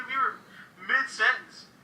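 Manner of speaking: normal talking voice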